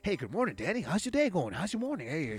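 cheery greeter impression. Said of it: "with your energy".

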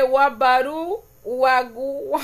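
sounding out Wabaru slowly, syllable by syllable; slow careful reading. Shown as a demonstration. Streamer slowly sounding out a viewer's Garífuna comment. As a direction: stumble through the Garífuna word slowly. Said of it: "vamos a leer despacio".

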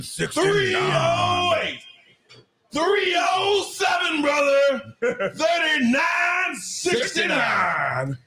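slow, high hyped promo shout; wrestling promo. Shown as a demonstration. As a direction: drag it out like Hulk Hogan. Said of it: "as if you were a wrestler cutting a promo".

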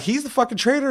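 very high mock-alarmed outcry; retold instant accusation. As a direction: squeal the accusation at first sight.

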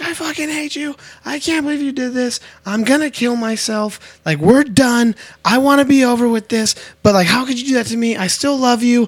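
high-pitched mock hysterics; screaming, crying girlfriend. Girlfriend fighting with her man in the street, mock hysterical. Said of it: "it's a girl screaming, crying".

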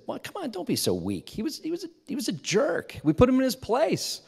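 indignant high-pitched justification; defensive dismissal. Retold or imitated. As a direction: wave away his shame. Retold defensive brushing off.

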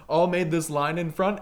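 ordinary delivery

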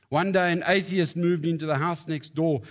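ordinary delivery

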